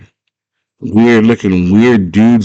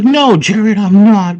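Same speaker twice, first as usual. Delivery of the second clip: high-pitched mock protest; hammed-up squeaky defensiveness; cowardly denial; 14 semitones higher, 4 dB louder, slower than usual. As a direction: deny it like a scared cartoon coward. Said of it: "And he's a coward".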